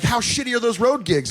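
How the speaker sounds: high, sing-song laughing question; laughing uncle. Retold or imitated. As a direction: tease him with glee. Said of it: "he'd be like".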